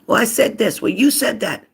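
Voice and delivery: high-pitched bickering yell; mock yelling match. Acting out two people in a yelling match, mock yelling. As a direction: jump up high and trade accusations. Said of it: "a yelling match".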